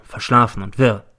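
ordinary delivery

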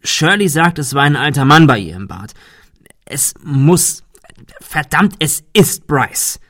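rising panic, words tumbling out; a frightened man blurting his suspicion; agitated. A narrator's reading. Notary panicking to the group, agitated and loud.

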